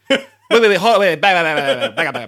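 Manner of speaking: loud, high, laughing stop-everything outburst; hammed-up disbelief, words tumbling out; incredulous interruption. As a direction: halt the speaker in amused disbelief. Tourists halting the guide in disbelief.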